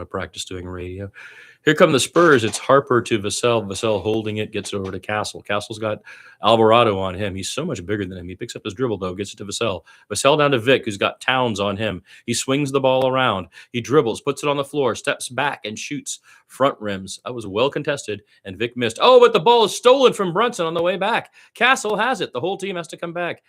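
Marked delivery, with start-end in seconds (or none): 1.6-19.0 lively radio play-by-play call of a Spurs game. Acted. Fan practicing radio play-by-play. 19.0-22.3 sudden jump up, higher and louder on the steal; excited call. Acted. Steal during live call.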